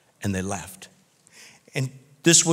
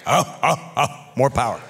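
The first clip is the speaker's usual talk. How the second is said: lightly hammed-up grunts for more power; macho grunting; 4 semitones lower than usual. Showing off a truck winch, macho grunting.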